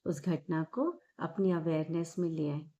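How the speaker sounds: calm, unhurried meditation guide with long pauses; measured, soothing guidance, drawing out the breathing cues; guided meditation. Acted. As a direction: guide them gently inward, phrase by phrase.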